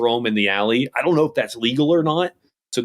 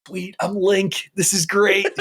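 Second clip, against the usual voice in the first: higher-pitched, slow mock delight; mock excitement; 7 semitones higher, slower than usual. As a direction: tease with a gleeful gamer's inner voice. Mimics co-host's secret delight playing.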